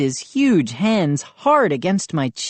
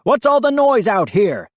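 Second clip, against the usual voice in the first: louder, higher shout; 7 semitones higher, 4 dB louder than usual. A narrator's reading. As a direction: demand from the doorway. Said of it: "Mister McCall shout".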